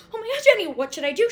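high, loud panicked squeal; frantic excitement. Retold or imitated. Spotting her crush, panicking to her best friend, high excited squeal. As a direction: blurt it out in a rush of nerves.

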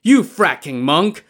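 furious accusation; enraged shout. A narrator's reading. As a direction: explode at the monk in rage. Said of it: "flew into a rage".

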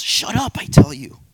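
sudden high, loud snarl; snarling man. Acted. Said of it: "he turns and snarls".

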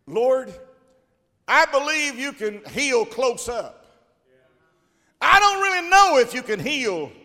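high, loud and urgent, then pulling back; pleading nobleman. Acted. Preacher voicing the nobleman pleading with Jesus to heal from afar. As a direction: beg him as a desperate father.

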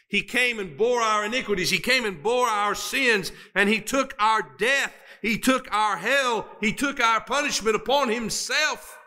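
high, ringing preaching climb; preaching climax. Acted. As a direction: preach it up to the peak.

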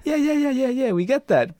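high, rapid run of yeahs; placating brush-off. Acted. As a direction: humour him to cut him off. Acting impatient studio staff to actor.

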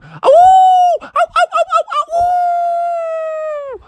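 very high yipping howls, last one long; yip, then howl like a wolf. Acted. Imitated wolf howls while chasing.

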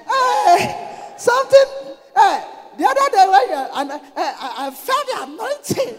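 very high, loud, hammed-up rapture; mock overwhelmed gushing. Flatterer telling papa they felt the anointing, mock high-pitched gushing. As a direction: squeal as if overcome by the sermon. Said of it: "you say".

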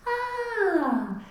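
put-on mom echoing a high baby coo; mirrored high coo. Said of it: "the mom goes".

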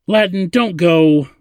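slow, high, sorrowful plea; pleading, sympathetic. A narrator's reading. Zadeh asks Ladin to stay, pleading. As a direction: plead with him, drawing it out. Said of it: "her voice pleading and sympathetic".

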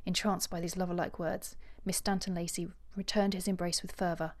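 measured audiobook reading with character voices; composed literary storytelling; story narration. A narrator's reading. Reads novel passage aloud.

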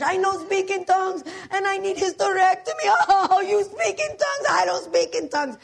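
put-on loud, high wailing with a Spanish accent; hammed-up hysterics in a mock Spanish accent; hysterical Spanish accent. Maria upset over tongues and surgery, hysterical Spanish accent. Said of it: "she's hysterical over this".

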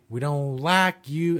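slow, drawn-out Southern drawl; exaggerated US Southern accent, words stretched out. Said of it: "I did it in a really southern accent".

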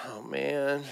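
soft, sighing reluctance; reluctant. Acted. Nephew asked by Uncle David to go along, reluctant. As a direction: sigh it quietly, dreading the job.

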